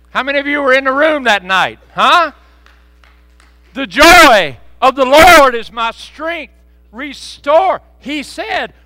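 loud, high, fervent revival preaching; preaching at full force. Acted. Pastor retelling Roger's prayer to the congregation, loud and fervent. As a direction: shout the testimony to the church.

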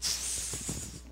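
soft hissed tss sound; a quiet shushing hiss; angel hiss. Acted. Angel silencing Peter, soft hiss. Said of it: "the angel flew down to Peter and said".

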